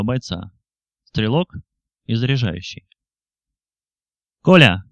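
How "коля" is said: loud sharp call to the gunner. Acted. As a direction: snap his name across the trench. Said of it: "бросил Ерофеев стрелку". (окрик командира)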